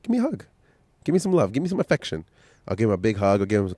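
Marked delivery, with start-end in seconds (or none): 0.0-2.2 much higher, sing-song plea for a hug; playful coaxing. Acted. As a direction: coax the grumpy kids in a high teasing voice. Dad coaxing his upset kids.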